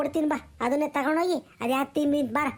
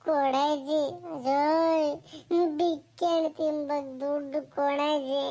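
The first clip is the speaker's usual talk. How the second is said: put-on slow, tearful child's pleading; acted crying grandchild; whining cry; slower than usual. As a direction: whine and drag out every word like a begging child. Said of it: "ಯಾಕೆ ನಿನ್ ಮೊಮ್ಮಗ ಅಳ್ತಾ ಇದ್ದಾನೆ".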